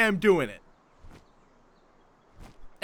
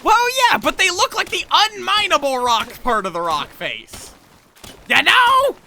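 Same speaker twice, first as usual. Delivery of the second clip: loud, high indignant protest; exasperated rant; 9 semitones higher, 8 dB louder than usual. Real, not acted. Insisting to viewers the ore is hidden, loud indignant rant. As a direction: argue your point hard and high.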